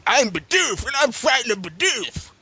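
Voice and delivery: high shouted cartoon creature voice; squeaky Pokemon voice. Imitating Pokemon voice.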